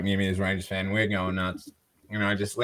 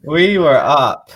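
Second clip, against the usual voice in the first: loud, high, drawn-out lament; anguished emphasis; 7 semitones higher, 10 dB louder, much slower than usual. Real, not acted. Fan mourning the lost Super Bowl, drawn-out exasperation. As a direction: stretch every word out in loud disbelief.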